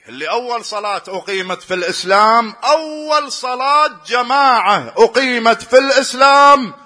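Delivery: high, loud impassioned sermon; fiery preaching. Acted. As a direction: preach it at full force, pleading. Preaching at full force again.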